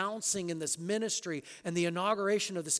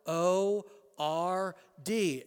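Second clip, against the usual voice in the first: each letter "L O R D" spaced and slow; spelled out slowly; much slower than usual. Shown as a demonstration. Preacher spelling the all-caps word, slow and deliberate. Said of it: "it's all uppercase letters".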